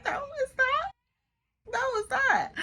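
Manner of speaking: high, squeaky, unconvincing no; feigned denial. Retold or imitated. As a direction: deny it in a very high voice, badly covering. Feigned denial to calm a friend.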